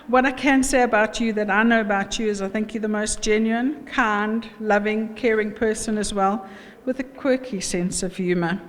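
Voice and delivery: ordinary talk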